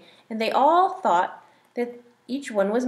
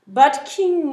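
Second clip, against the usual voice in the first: pleading cry; 5 semitones higher, 7 dB louder than usual. Acted. Python pleads with king.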